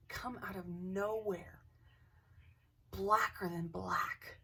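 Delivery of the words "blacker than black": slow, weighted, each word leaned on; slow emphasis. Acted. Describing the shadow figure to the camera, slow and weighty. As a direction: drag it out to make it ominous.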